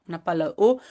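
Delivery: normal talking voice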